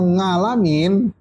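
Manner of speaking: Javanese-style NGALAMIN with ng for ain; slow "ngalamin" in a Javanese way; Javanese accent. Shown as a demonstration. Teacher joking about Javanese readers, Javanese ng. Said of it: "orang Jawa".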